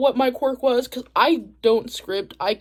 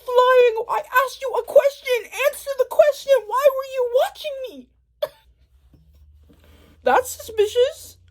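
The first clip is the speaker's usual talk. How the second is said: high indignant scolding; exasperated rant; 12 semitones higher than usual. Retold or imitated. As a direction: demand answers from someone already gone. Ranting at Hawks flying off.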